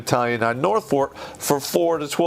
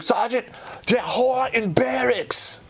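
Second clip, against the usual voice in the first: much higher, slow broken English; broken-English sergeant major; 10 semitones higher, slower than usual. Retold or imitated. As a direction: tell the team leader in broken English. Sergeant major answering team leader.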